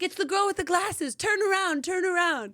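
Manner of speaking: high, loud eager Italian man call; gelato man calling out. Retold or imitated. Gelato maker calling the girl with glasses, high and loud. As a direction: call her over like the proud gelato maker. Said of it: "he's like".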